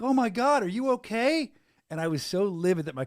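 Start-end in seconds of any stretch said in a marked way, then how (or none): 0.0-1.5 put-on concerned young girls. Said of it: "And they're like".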